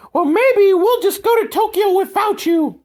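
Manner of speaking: snooty mom. Retold or imitated. Podcaster mocks the British mom threatening her son, high mock-posh. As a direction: sing out a haughty threat to leave him. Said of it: "And she's like".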